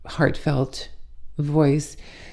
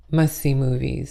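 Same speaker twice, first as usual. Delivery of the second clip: ordinary talk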